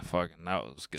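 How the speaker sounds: low, very soft, satisfied murmur; sated sigh. Acted. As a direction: sigh it quietly, as if after a feast. Acting sated after eating.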